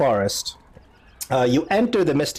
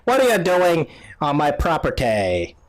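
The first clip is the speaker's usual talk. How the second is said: put-on creaky old woman challenging intruders; old lady. Old lady questions strangers on her property, old lady voice. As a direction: play a suspicious old hut dweller. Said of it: "and she's like".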